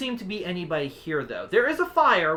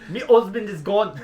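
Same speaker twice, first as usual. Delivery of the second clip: loud, higher mock-Jamaican; Jamaican accent; 4 semitones higher, 8 dB louder than usual. Shown as a demonstration. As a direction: repeat it in a hammed-up Jamaican accent. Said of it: "You're going with the Jamaican accent".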